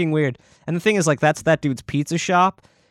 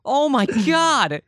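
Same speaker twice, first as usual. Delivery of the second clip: stunned disbelief; 10 semitones higher, much slower than usual. Real, not acted. Stunned reaction to the story.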